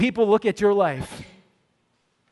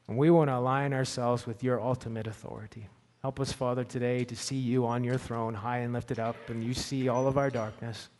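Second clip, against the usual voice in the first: soft, lower reverent prayer voice; 5 semitones lower, 5 dB quieter than usual. Acted. Pastor leading the congregation in prayer, hushed and reverent. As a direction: pray quietly on behalf of the church.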